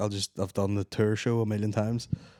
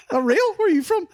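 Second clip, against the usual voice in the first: baffled; 14 semitones higher than usual. Retold or imitated. Hearing the Enniskillen barman, high baffled disbelief. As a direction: squeak out your disbelief at the barman. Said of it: "I was like".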